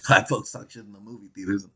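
usual speaking voice

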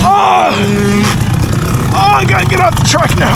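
high strained cries after crash; pained panicked cry. Real, not acted. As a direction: gasp it out, hurt and scared. Just crashed, in pain.

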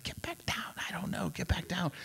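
urgent hushed order, all whispered; hissed whisper. Retold or imitated. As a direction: whisper sharply to a friend mid-prayer. Whispered reply during silent prayer.

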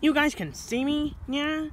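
high-pitched astonished question; amazed. Acted. Cat yokai learns humans see him, amazed. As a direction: pitch leaps up in disbelief.

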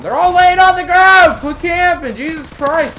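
exasperated gamer yelling; angry shout. Real, not acted. Gamer raging at campers laying on the ground, angry shout.

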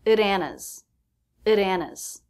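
"At Anna's" with the T tapped into "Anna's"; flap T. Shown as a demonstration. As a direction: link the T lightly into the vowel. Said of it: "so the T is a flap T".

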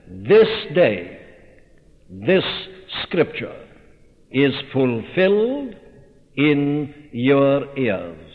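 each phrase set apart by long pauses, slowing at the end; weighty pauses. Acted. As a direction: drop the words one by one, slowly, as a solemn proclamation. Quoting Jesus in the synagogue.

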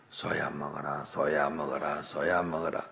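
put-on low, soft monotone repetition, like a child absorbed in feeding; child's quiet repeated coaxing. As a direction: keep urging softly in a low, even murmur. Child chanting at dead ox.

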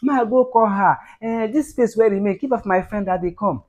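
high-pitched mimicked girl's request; slim girl. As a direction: put on a girl's high voice, asking a favour. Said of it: "She can't say".

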